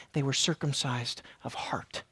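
whispered, hushed phrase. Real, not acted. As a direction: breathe it in a whisper.

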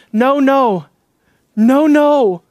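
"No, no" hit hard, falling; emphatic refusal. Acted. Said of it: "cannot say it more emphatically".

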